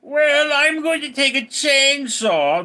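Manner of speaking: mock moron voice; loud, slow, high-pitched dimwit; exaggerated idiot voice, drawn out high; Elon Musk. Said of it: "I think he sounds like a complete moron".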